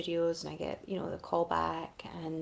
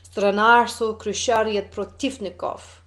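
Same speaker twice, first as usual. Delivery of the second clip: slow, louder fake Russian, carefully sounded out; mock Russian accent; 2 semitones higher, 9 dB louder, slower than usual. Remembering her audition lines, slower and louder in fake Russian. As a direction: recite the garbled Russian slowly in a put-on Russian accent. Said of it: "I remembered it like".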